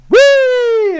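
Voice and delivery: high squealing whee of a jump; imitated whee. Dad imitating his daughter's jump, high squeal.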